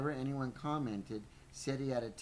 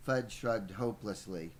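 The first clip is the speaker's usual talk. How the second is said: plain reading voice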